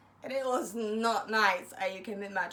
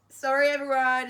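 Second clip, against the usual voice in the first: calling out; 4 semitones higher, 9 dB louder than usual. Retold or imitated. Calling out to the whole college.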